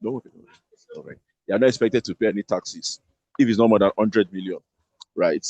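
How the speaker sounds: ordinary delivery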